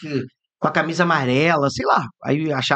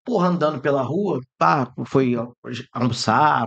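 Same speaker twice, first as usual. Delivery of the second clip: ordinary talk, nothing marked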